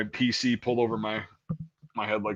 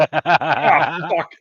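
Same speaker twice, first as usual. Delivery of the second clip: laughing dismay; 4 semitones higher, 6 dB louder than usual. Retold or imitated. Caught by recruiter, laughing.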